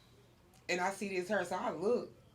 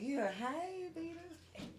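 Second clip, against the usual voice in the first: hushed hey; 5 semitones higher, 13 dB quieter, much slower than usual. Retold or imitated. Greeting across bar, hushed.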